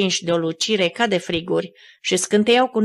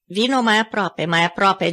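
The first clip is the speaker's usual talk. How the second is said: ordinary delivery, nothing marked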